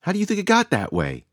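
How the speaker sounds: a woman demanding an answer about the stranger's arm, insistent; retorting, high and insistent. A narrator's reading. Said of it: "Shishani retorted".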